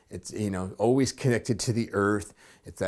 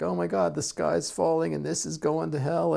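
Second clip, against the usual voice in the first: mock freak-out; 6 semitones higher than usual. Workplace freaking out that the sky's falling, mock panic. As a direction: imitate coworkers lightly freaking out. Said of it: "your workplace is freaking out".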